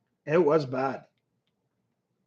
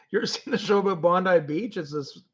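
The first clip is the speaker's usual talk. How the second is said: ordinary talk, nothing marked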